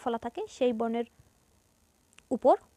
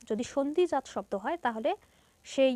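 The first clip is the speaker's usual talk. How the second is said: ordinary delivery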